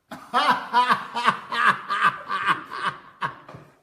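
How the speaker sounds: loud, high peals of laughter; big laugh. Real, not acted. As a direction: burst into a long, ringing laugh. Amused while arranging people on camera.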